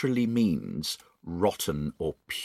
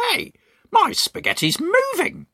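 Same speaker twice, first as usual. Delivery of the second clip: put-on much higher, alarmed cry of a cranky old man; Mister Twit crying out. Mister Twit finds his dinner moving, high startled cry. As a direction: shriek it like a startled grumpy husband. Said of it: "cried Mister Twit".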